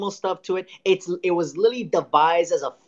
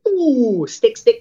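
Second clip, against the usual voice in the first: high, loud sing-song tempter; hammed-up devil luring him in; mock devil voice; 6 semitones higher, 6 dB louder, much slower than usual.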